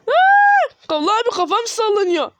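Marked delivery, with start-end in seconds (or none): 0.1-0.7 shrill scream. Real, not acted. Jump scare in the game.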